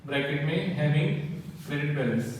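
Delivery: very slow, word-by-word dictation with long pauses. Acted. Teacher dictates the theory answer to students, very slow. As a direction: dictate each word so the class can write it down.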